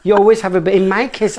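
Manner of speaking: ordinary delivery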